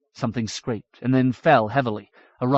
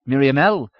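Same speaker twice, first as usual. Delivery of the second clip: calling out; 2 semitones higher, 7 dB louder than usual. A narrator's reading. Binabik calls to fallen Miriamel.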